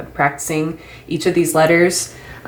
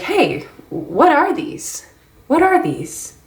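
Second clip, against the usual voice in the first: high, exaggerated questioning tone; questioning intonation; 12 semitones higher, slower than usual. Shown as a demonstration. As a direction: swoop up high like a puzzled shrug. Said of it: "use that intonation in your voice".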